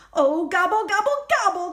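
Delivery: usual speaking voice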